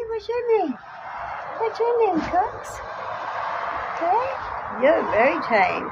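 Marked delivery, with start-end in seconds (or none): 0.1-4.8 high singsong voice coaxing a bird; cooing up high at a pet bird; high coaxing pet talk. Real, not acted. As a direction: sweet-talk the cockatoo, pitched way up.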